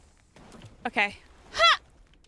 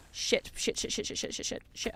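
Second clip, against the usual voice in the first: breathless whispered curses, fading; panicked whisper; 8 dB quieter than usual. Real, not acted. Streamer panicking at flame jellies, whispered curses fading. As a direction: hiss it under your breath in panic.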